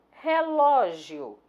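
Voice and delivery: "Relógio" stretched out, weight on "ló"; stress on middle syllable. Shown as a demonstration. Teacher showing the tonic syllable, "Relógio" stressed on "ló". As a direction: say "Relógio" slowly, leaning on "ló". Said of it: "A sílaba tônica é o ló".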